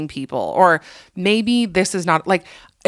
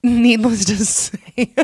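breaking into a real laugh mid-phrase; slowed by laughter, higher pitch; 5 semitones higher, slower than usual. Real, not acted. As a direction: crack up while getting the words out, slowly. Cracks up at misheard trailer line.

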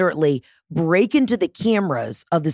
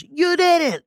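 very high, indignant comeback; mock-outraged squeal of accusation; accusing gotcha; 11 semitones higher than usual. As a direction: catch them out, pitch shooting up. Retells own accusation of the caller.

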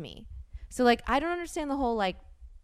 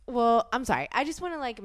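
ordinary talk, nothing marked

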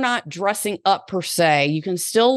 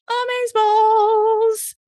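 amazeballs sung out high and slow; gushing over the next episode; much slower than usual. Real, not acted. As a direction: stretch amazeballs up high with delight. Gushing about next week's episode.

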